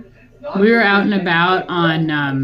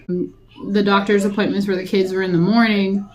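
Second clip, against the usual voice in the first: normal talking voice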